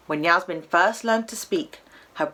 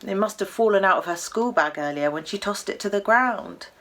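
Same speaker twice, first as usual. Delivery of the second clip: ordinary delivery, nothing marked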